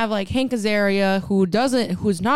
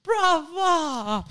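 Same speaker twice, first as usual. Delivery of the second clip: mock brava cheer; 8 semitones higher, much slower than usual. Audience cheer imitated.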